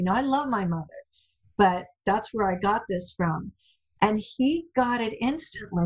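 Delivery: normal talking voice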